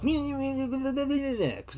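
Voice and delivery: high, slow put-on French gibberish; mock French babble. Mocking French tourists on a bridge, mock French babble. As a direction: mimic French chatter mockingly. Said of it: "'cause that's how they talk".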